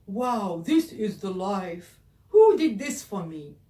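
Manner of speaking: loud, amazed beast voice. Acted. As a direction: boom it out as the surprised beast. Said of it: "he said".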